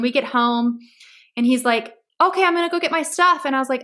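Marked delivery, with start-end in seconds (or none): 2.2-3.4 put-on high, quick voice of a kid; imitated child. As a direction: lightly mimic an oblivious child, fast and high. Child voiced in parent's story.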